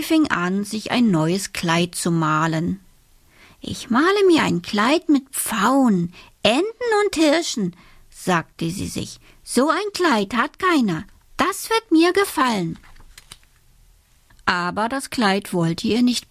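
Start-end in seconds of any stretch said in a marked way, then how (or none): 3.6-7.8 put-on high, quick girlish voice; bright child's voice, lifted high; little girl Alice. A narrator's reading. 9.4-12.8 put-on high, quick girlish voice; bright child's voice, lifted high; little girl Alice. A narrator's reading.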